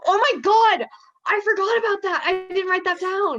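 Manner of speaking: high, loud excited rush. Real, not acted. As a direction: burst out with delight. Remembering the best moment.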